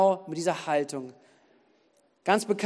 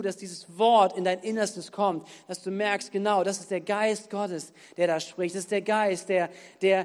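ordinary delivery

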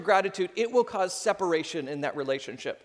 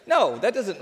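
laughing; 5 semitones higher, 5 dB louder than usual. Real, not acted. Laughing at own joke.

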